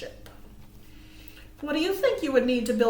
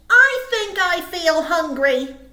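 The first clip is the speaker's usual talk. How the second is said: Little Bird; 5 semitones higher, 9 dB louder than usual. Acted. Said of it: "Little Bird said".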